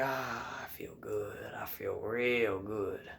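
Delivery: low, soft contented murmur; hushed, self-satisfied sigh of words; savoring. Retold or imitated. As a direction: sink into it, slowing at the end. Savoring the two catches.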